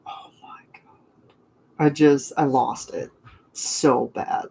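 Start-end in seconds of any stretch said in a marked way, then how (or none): 0.1-0.8 hushed whisper of disbelief; whispered awe; retold whisper. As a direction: breathe it out, stunned. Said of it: "I was like".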